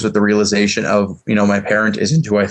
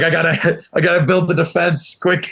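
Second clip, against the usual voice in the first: high mock-frantic voice for Trevor; mock panicked Trevor; 8 semitones higher than usual. Teasing Trevor about scrambling for his argument, high mock panic. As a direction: squeak it out like he's scrambling for a defense. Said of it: "Trevor's like".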